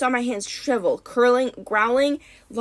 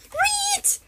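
very high squeal of protest; sharp rodent squeal; protesting guinea pig squeak. A narrator's reading. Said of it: "I protested".

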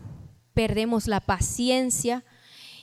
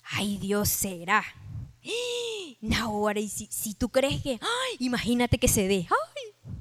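giddy doubt; 7 dB quieter than usual. Acted. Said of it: "a veces las promesas te hacen reír".

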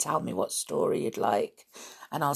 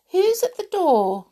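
very high, louder princess voice; sweet calling voice; 13 semitones higher, 5 dB louder than usual. A narrator's reading. Princess calls from inside the castle, sweetly. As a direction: call out sweetly to whoever's there. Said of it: "a sweet voice called".

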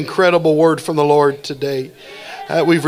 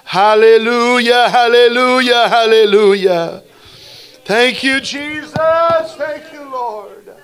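fervent worship; 4 semitones higher than usual. Real, not acted. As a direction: lead the congregation in sustained, intoned hallelujahs. Leads congregation in praise and prayer.

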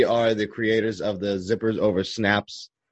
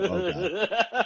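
high, breathy laughing "oh God"; horrified yet amused; laughing dismay; 11 semitones higher, 4 dB quieter than usual. Retold or imitated. Dad as the iPad hits the baby's head, laughing in dismay. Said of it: "And I just go".

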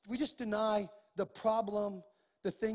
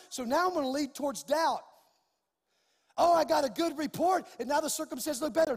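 higher, driving preacher's delivery; impassioned preaching; 5 semitones higher, 6 dB louder than usual. Real, not acted. Preacher challenging the congregation on doubt, impassioned preaching. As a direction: preach with force, building to a loud declaration.

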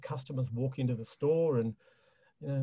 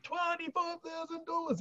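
put-on much higher, softer Greek accent on "Twenty-five thousand dollars"; dad's Greek accent; 7 dB quieter than usual. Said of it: "and his great accent".

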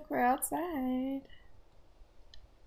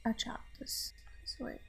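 sighed whisper; 8 dB quieter than usual. Real, not acted. Player sighing to partner about her character's wig, whispered. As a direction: breathe it out on a sigh. Quiet sighed remark while playing.

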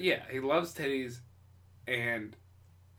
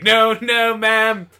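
put-on loud, higher, slow old-time voice; forties chauvinist; 4 semitones higher, 9 dB louder, slower than usual. Misogynist putting the woman in her place in forties voice. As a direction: scold her like a vintage sexist. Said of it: "And he's like".

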